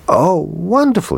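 put-on high, delighted little boy; a boy thrilled by his present; boy crying out over the tin soldier; excited cry. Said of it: "he cried".

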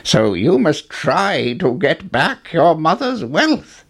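a lightly voiced old man, slow and earnest. A narrator's reading. Old man urging Jack to win back the wealth, slow. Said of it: "the old man continued".

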